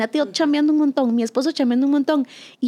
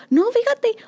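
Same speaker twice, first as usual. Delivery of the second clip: high mock correction; 10 semitones higher, slower than usual. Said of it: "Y yo".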